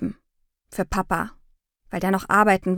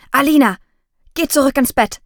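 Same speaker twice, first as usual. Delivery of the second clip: higher, louder call down the hall; 4 semitones higher, 6 dB louder than usual. Acted. Mother calls out to her daughter in the hall at night, firmly. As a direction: send the child back to bed, loudly.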